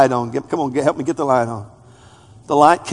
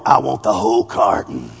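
greedy inner voice; 8 semitones lower than usual. Retold or imitated.

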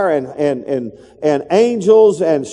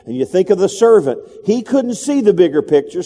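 ordinary talk

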